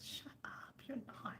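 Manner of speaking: playful whisper, mock scolding; whispered quote. Telling the elder to hush, playful whisper. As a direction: whisper it like a teasing friend. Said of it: "I said".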